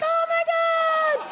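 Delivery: long, high squeal of relief; squealing gasp. Retold or imitated. The guys squeal at the cop's reveal, high and drawn out. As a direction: stretch out a shrieking oh my God.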